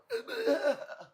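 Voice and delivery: sudden high-pitched laugh burst; high squealing laugh. Real, not acted. Cracks up at his own joke.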